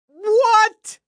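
very high, loud shocked what; shocked shriek. Acted. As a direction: squeal in total disbelief. Reader shocked.

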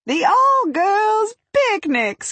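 high, slow, delighted exclamation; excited cry. A narrator's reading. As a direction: cry it out with glee. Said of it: "Kat cried".

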